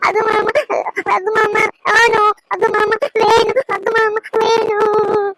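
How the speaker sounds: slow, high, drawn-out wail; tearful high-pitched whining demand; crying child. Acted. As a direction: bawl stubbornly like a small child. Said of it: "இப்படி அழுதுகிட்டே இருக்கா".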